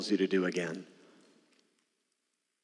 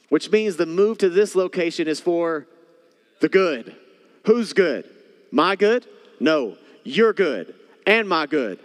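higher, louder, emphatic preaching; rising preaching fervor; 6 semitones higher, 4 dB louder than usual. Acted. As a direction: build it up, punching each good. Preaching builds to climax.

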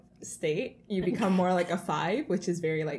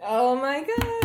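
excited dread; 7 semitones higher, 6 dB louder, slower than usual. Real, not acted. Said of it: "I'm really excited and nervous".

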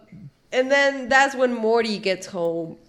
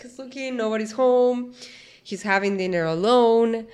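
ordinary delivery

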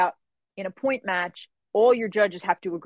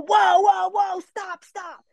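alarmed referee shout; 10 semitones higher, much slower than usual. Retold or imitated. Center judge stops the illegal punch: high alarmed shout. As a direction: yell whoa like a judge stopping a fight. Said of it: "I hear Mister Peoples go".

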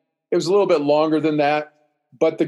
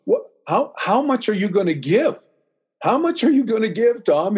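high, insistent questioning; pleading challenge; 7 semitones higher than usual. Retold or imitated. Friend asking Tom about his sacrifice, insistent. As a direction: press the question harder on the repeat.

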